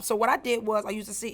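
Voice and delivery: usual speaking voice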